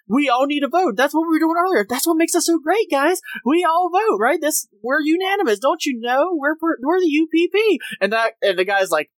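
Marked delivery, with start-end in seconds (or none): 0.1-7.8 high-pitched, preachy parody of group pride; rally the crew with overeager, hammed-up insistence; mock zeal. Parodies crew member's zeal for voting.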